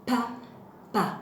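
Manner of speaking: Pa, pa as two separate beats with a pause between; split into syllables. Shown as a demonstration. Teacher shows both P syllables to children, Pa, pa split apart. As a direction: say each short syllable of Pa, pa on its own. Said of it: "dans la première syllabe, dans la deuxième syllabe".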